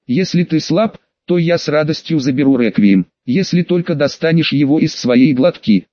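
ordinary delivery, nothing marked

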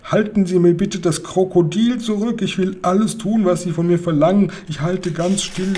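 high, whining, frightened begging; pleading. A narrator's reading. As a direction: beg for mercy, promising anything. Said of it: "flehte Hotzenplotz".

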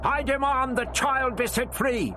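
put-on high, indignant old man raging; raging demand. A narrator's reading. The Doctor raging up at the voice in the dome. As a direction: hurl it up into the dome. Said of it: "raged into it".

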